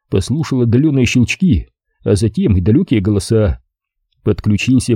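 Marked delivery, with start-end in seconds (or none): none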